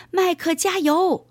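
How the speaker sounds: high-pitched cheer, shouted out. A narrator's reading. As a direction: cheer on a runner at the top of a narrator's range. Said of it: "大声地叫道". (高声呐喊加油)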